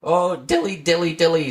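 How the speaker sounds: sighing cat. A narrator's reading. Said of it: "sighed Simpkin".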